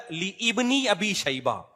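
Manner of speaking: "لی ابن" slow and split, li ibni; imitated false correction. Scholar quoting the Engineer correcting others, slow mispronounced li-ibni. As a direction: draw out "لی ابن" with the alif fully sounded. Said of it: "غلط پڑھتے ہیں".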